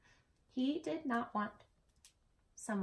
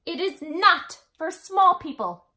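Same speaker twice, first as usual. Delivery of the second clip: loud, higher and a bit slow; indignant refusal; 8 semitones higher, 9 dB louder than usual. A narrator's reading. Big brother refusing the small person, loud and firm. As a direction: lay down the law to the little one. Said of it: "said Elmore".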